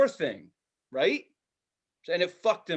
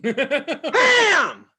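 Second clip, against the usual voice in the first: high, loud shout of "Pam!"; shouted name; 11 semitones higher, 9 dB louder than usual. Shown as a demonstration. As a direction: yell the random name at the ride. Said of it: "Like that".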